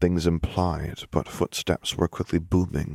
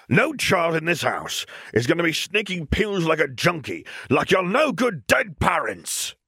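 raging uncle; 11 semitones higher than usual. Acted. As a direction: spit the insult about her parents. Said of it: "continued to rage".

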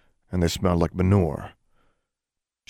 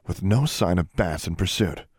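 plain reading voice

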